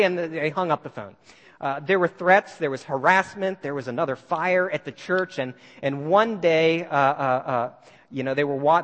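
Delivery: ordinary talk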